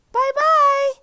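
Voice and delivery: very high, loud squeaky call; high squeaky cub calling goodbye. A narrator's reading. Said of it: "a high squeaky voice calling".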